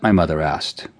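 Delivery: plain reading voice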